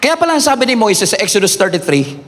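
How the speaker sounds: ordinary delivery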